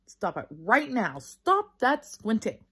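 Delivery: put-on mild bossy little-girl scolding; bossy demand. A narrator's reading. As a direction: order her to stop. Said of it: "she asked".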